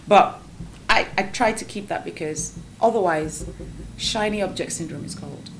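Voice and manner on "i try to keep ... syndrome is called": ordinary talk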